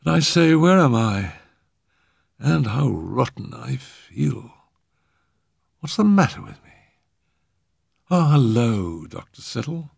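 bewildered. A narrator's reading. Revived Arthur trying to place himself, bewildered. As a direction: come round confused and groggy. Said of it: "He looked round with increasing bewilderment".

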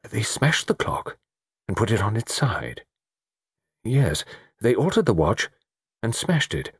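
halting reconstruction with pauses; tentative, piecing it together; hesitant. A narrator's reading. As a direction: feel your way from step to step.

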